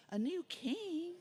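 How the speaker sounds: high, slow, amazed; surprised question. A narrator's reading. Boys hearing news of a new king, high amazed question. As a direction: gasp out the question in disbelief.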